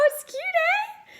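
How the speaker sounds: very high, slow, drawn-out question; sing-song lift fishing for agreement; playful eh demo. Shown as a demonstration. Canadian echoing a viewer's eh example, playful and very high. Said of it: "That's exactly how you use it".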